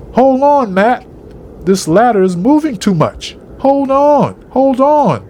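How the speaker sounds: high, urgent shout. A narrator's reading. Fred to Matt on a moving ladder, shouted. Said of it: "Fred shouted".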